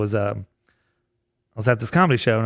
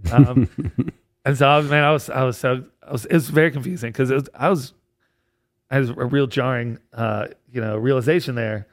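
normal talking voice

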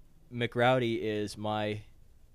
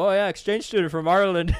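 mock Irish accent; 9 semitones higher, 10 dB louder than usual. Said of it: "Irish accent".

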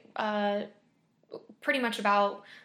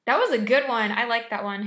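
excited; 9 dB louder than usual. Real, not acted. Loud delighted reaction after story.

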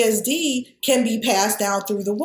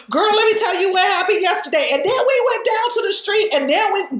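much higher, fast, lively chatty tone with a friend; gossiping casually with your sister, guard down; relaxed family slang; 9 semitones higher than usual. Retold or imitated. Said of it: "I can talk to my sister".

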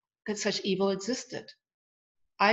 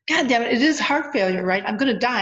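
exasperated, louder cry of despair; frustrated outburst; 4 semitones higher, 7 dB louder than usual. Retold or imitated. Dying woman swearing at silent spirits in exasperation.

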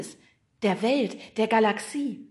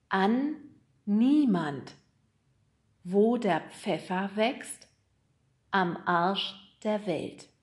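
slow, word by word with pauses; reading an address; 3 dB louder, much slower than usual. Acted. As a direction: read it out slowly, piece by piece.

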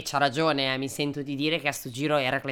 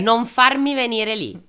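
higher, louder mum with the slipper; threatening mum; 7 semitones higher, 8 dB louder than usual. Retold or imitated. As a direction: warn like an angry mother. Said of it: "È la mamma con la ciabatta". (mamma minacciosa con la ciabatta)

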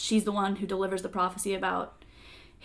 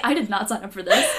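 laughing quote; 8 dB louder than usual. Retold or imitated. Laughing while voicing Harry.

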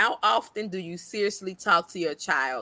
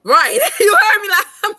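loud laughing; 13 semitones higher, 12 dB louder than usual. Real, not acted. Laughing, loud reaction to friend.

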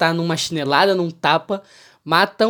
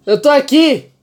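bêbado; 7 semitones higher, 6 dB louder than usual. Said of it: "Aí ele falou".

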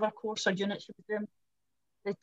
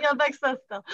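higher, firm mock insistence; pointedly correcting, pitched up; insistent correction; 4 semitones higher, 4 dB louder than usual. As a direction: put them straight, playfully emphatic. Insists on being the sister.

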